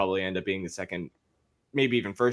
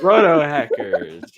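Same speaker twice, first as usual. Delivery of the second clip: announcer voice; 3 semitones higher, 6 dB louder, much slower than usual. Pretend. Announcing the show name.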